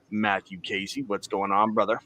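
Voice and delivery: ordinary talk, nothing marked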